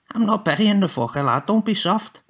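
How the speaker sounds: high, scornful rough voice; mock the boy's softness; hard case scoffing at the lad; scoffing grandad Tommy. A narrator's reading.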